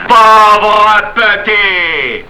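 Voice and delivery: very slow, high, drawn-out taunt; escalated taunt. Acted. Panisse nose to nose with Marius, drawn-out furious taunt. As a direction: stretch each vowel out menacingly.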